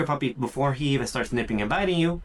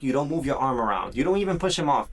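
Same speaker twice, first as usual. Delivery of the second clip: usual speaking voice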